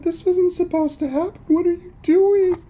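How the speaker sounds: high, loud, panicky cry; frantic calling. Acted. Ghost chasing his stolen sheet, frantic calling. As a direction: call after them, building to a wail. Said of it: "the ghost called".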